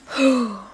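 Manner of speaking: sharp real coughs; coughing fit. Real, not acted. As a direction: cough hard into the mic. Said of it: "Nicht wundern, ich hab Husten".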